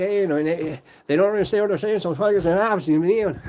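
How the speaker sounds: barely intelligible Irish jabber; Brad Pitt. Retold or imitated. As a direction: run the words together in a mock Irish Traveller accent. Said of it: "you can't understand what he's saying".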